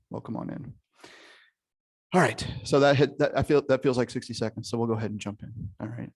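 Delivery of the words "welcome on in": breathy whispered welcome; whispering. Shown as a demonstration. Greeting people joining the call.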